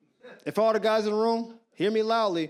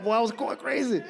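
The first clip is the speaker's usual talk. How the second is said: ordinary delivery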